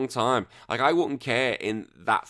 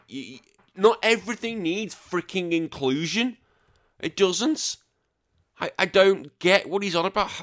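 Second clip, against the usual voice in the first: higher, rising indignation; heated rant; 8 semitones higher than usual. Real, not acted. Vlogger ranting at the actor's complaint, higher and indignant. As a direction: push back hard, worked up. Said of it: "ranting at the actor".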